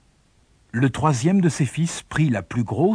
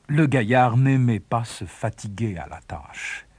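usual speaking voice

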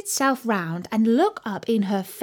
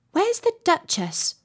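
lightly voiced girl, higher pitch; curious Alice; 9 semitones higher than usual. A narrator's reading. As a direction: ask it in a high girlish voice.

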